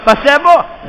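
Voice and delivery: preaching cry. Acted. Rhetorical question shouted.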